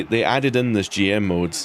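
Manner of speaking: ordinary delivery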